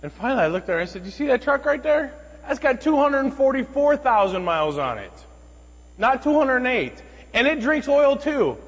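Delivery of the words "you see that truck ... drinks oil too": high, loud one-upping comeback; retold outburst in a raised, pitched-up voice; exasperated retort. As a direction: throw the complaint right back, slowing to punch the ending. Retorts in kind to woman's complaint.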